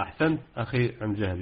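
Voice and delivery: ordinary delivery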